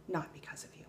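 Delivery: hushed pointed whisper. Real, not acted. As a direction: drop to a breath to land it. Pointed ending to rant.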